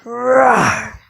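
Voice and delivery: rough imitated lion roar. Parent showing a child the lion's noise, playful roar.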